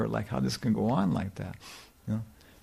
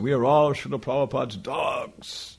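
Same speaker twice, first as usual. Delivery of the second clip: slow, weighty lecture quote; quoting Prithu's lecture; 2 semitones higher than usual. Retold or imitated. Retelling Prithu's famous lecture, slow and solemn. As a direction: deliver it slowly like a preacher. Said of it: "He said".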